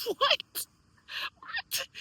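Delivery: high, squeaky disbelief; baffled what. Acted. Speaker reacts to family's mixed messages, high and incredulous. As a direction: let the pitch leap in mock bafflement.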